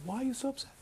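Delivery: high, gentle questioning; concerned questioning. Retold or imitated. Retells consoling a crying student.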